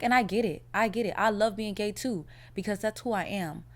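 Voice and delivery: ordinary talk, nothing marked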